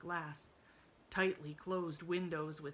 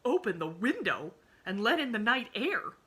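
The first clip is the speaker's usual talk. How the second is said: loud, higher indignant outburst; shocked exclamation; 5 semitones higher, 10 dB louder than usual. A narrator's reading. Aunt Elizabeth recoils at opening the window, loud and shocked. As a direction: blurt it out, scandalised. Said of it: "she exclaimed".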